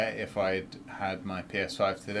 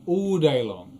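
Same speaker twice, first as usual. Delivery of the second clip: All day long louder and higher; emphatic; 4 semitones higher, 7 dB louder, slower than usual. Real, not acted. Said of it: "I'll take that all day long".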